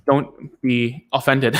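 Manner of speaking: ordinary talk, nothing marked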